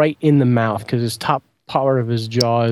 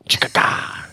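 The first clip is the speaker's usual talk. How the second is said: whispered loading click; 3 dB quieter, slower than usual. Retold or imitated. Imitates loading blade.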